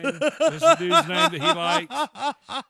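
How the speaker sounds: high, slow, laughing question. Real, not acted. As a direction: squeeze it out through laughter. Laughing while asking again.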